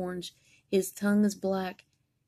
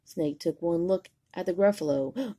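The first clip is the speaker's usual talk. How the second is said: usual speaking voice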